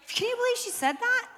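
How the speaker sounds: high, incredulous question; playful fake outrage; mock shock. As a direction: act scandalised for the crowd. Mock outrage at Emma's remark.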